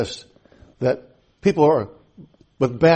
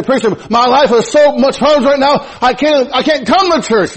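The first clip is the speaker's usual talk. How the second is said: complaining churchgoer; 10 semitones higher, 6 dB louder than usual. Retold or imitated. Quoting folks who say they cannot come.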